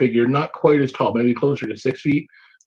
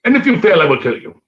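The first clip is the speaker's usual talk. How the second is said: louder, higher sudden threat; menacing threat; 5 semitones higher, 6 dB louder, faster than usual. Acted. Goblin king threatening the adventurers, loud and menacing. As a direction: snap the threat at them.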